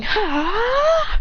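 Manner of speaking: rising high "ha" of effort; long imitated effort cry; battle yell. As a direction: yell as you hurl someone. Akane spins and throws Adrian.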